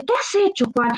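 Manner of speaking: high-pitched voice of the scolding master; a boss demanding to know what Juan did. Acted. Master confronts Juan about the skeletons, high scolding.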